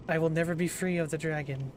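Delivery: ordinary talk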